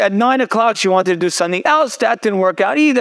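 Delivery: ordinary talk, nothing marked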